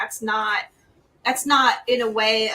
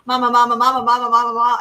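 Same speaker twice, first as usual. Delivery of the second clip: loud rapid-fire repetition, run together; insistent, unbroken calling; imitated pestering child; 7 dB louder, faster than usual. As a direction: lightly voice a kid nagging for mom. Voicing insistent child pestering her.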